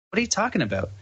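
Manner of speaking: high, puzzled question; imitating a baffled reaction; retold bafflement. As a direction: question him in disbelief, pitch lifted. Baffled by the man's confession.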